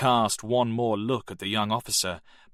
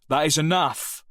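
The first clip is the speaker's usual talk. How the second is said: a louder, higher angry outburst; angry teacher; 7 semitones higher, 5 dB louder than usual. Acted.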